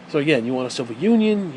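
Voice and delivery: normal talking voice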